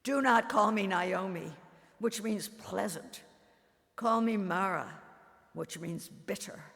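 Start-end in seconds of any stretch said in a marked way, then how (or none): 0.0-1.6 louder, fierce refusal; mother snapping at John in grief; blazing retort. Acted. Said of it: "my eyes blazing". 3.9-5.0 louder, fierce refusal; mother snapping at John in grief; blazing retort. Acted. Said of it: "my eyes blazing".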